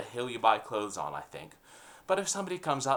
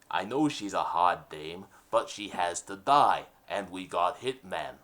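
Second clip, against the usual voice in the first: mafia boss; 6 semitones lower than usual. Acted. Boss insisting to henchman, low gangster voice.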